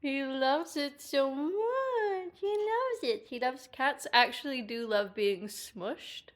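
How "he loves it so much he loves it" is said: slow, pitched-up coo. Real, not acted. Pet sitter showing the cat on camera, cooing.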